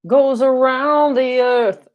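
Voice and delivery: high, lofty, slowed declaration; playful fake-solemn announcement; mock grand proclamation. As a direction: pronounce it like a grand cosmic truth, tongue in cheek. I'm just kidding.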